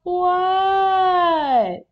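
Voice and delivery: high, very long-drawn shout; loud held yell, sliding down at the end; yelled answer. A narrator's reading. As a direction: bellow back to a calling parent. Froggy yells back at mom.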